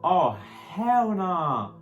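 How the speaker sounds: outraged hell nah. Real, not acted. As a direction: drag out "hell nah" in total disbelief. Repeats the reaction, bigger.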